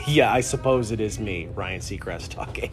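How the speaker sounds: ordinary delivery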